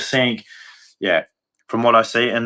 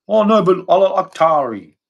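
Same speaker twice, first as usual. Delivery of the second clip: higher-pitched mock protest; mocking objection; 7 semitones higher, 4 dB louder than usual. Ranter parodying people he calls morons, mocking. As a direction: imitate a silly objector, pitched up.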